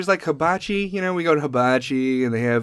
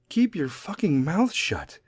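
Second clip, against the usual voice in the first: usual speaking voice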